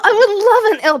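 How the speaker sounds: high, loud delighted acceptance; eager acceptance. Acted. Grendel answering her papa's offer of ale, delighted.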